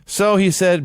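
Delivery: ordinary delivery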